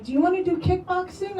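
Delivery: mock-eager invitation. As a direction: play the keen friend pitching an outing. Friends nagging her in retold quote.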